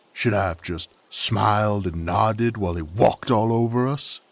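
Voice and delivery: corner him with bitter questions, building to a yell; escalating confrontation. Acted. Confronting the young lord, escalating.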